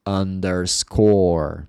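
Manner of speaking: underscore said slowly; slow model word. Shown as a demonstration. Teacher slowly giving the English word. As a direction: stretch out underscore. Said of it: "Se eu quero falar".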